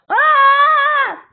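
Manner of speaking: high, loud mock scream; a loud, high copy of the singer's cry; imitated scream. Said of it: "That scream is like".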